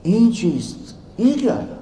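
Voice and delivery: high, hushed bewilderment; astonished question. Retold or imitated. Scholar holding his head at dry books, astonished question. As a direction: ask high, barely believing it.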